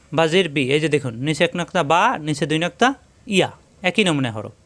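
ordinary talk

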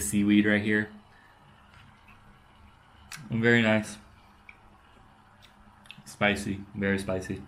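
talking between bites; muffled chewing speech; mouth full, chewing. Real, not acted. Seen 0: Seaweed eaten at -3; jaw and lips chewing while talking after.

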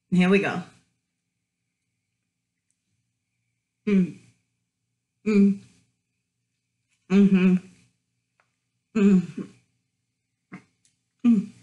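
chewing food; humming while chewing; mouth full. Real, not acted. Seen 0: holds burger at mouth biting, then chews with closed lips.

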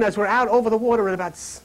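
normal talking voice

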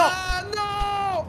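high, drawn-out no; high-pitched gamer shout of dismay; dismayed cry. Real, not acted. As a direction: wail it high as you lose. Loses in the gulag game.

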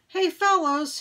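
high calling shout to the fellows; yelling. Acted. Henry shouts after Robert and Scooter, high. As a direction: call them back. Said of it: "he yelled again".

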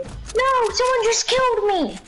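shrieked no, someone just killed me; yelling in dismay. Real, not acted. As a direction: scream as you get killed. Killed in game, screaming.

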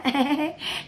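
mocking laugh. Real, not acted. Streamer scoffing at the rival's culture, mocking laugh. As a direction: laugh derisively at the boast.

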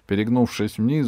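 ordinary delivery, nothing marked

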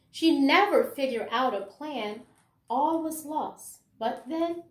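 lively, sing-song picture-book reading; reading to children. Acted. Teacher reading a rhyming picture book to her class, lively storytelling. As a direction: bring the rhymes alive for little listeners.

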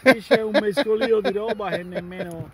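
loud complaint. Real, not acted.

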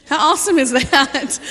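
loud, high, laughing through the words; laughing delight. Real, not acted. Laughing with delight while telling the church about it. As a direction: burst out laughing with joy. Excited aside about street greeting.

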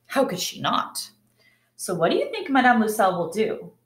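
usual speaking voice

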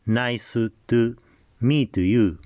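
katakana English, syllable by syllable; Japanese-accented English. Shown as a demonstration. Teacher showing learners typical Japanese English, Japanese accent. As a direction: add vowels after consonants, Japanese style. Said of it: "私の日本語、英語".

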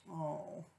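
usual speaking voice

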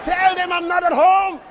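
hiding-from-visitors call. Acted.

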